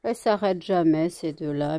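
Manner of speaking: ordinary talk, nothing marked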